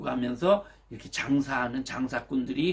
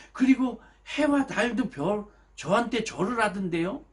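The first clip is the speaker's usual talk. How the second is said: put-on eager boasting boy; 7 semitones higher than usual. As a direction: show off the dream to the brothers. Joseph boasting second dream.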